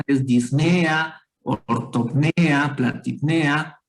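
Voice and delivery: "disnea", "ortopnea", "platipnea" said slowly, each lifting the E; drawn out, raising the voice on the E in each word; slow, stress on the E. Shown as a demonstration. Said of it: "la elevación del tono de voz está en la E".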